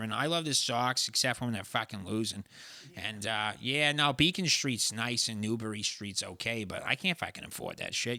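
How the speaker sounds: Trish-from-Somerville Boston talk; Boston accent. Shown as a demonstration. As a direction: ramble like a Boston local. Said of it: "You gotta sound like Trish".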